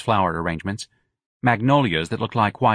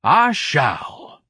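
higher, louder lofty reply; haughty exit; 6 semitones higher, 4 dB louder than usual. A narrator's reading. Ignatius leaves the art show, haughty. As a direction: agree with wounded dignity.

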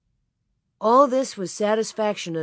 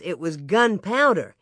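plain reading voice

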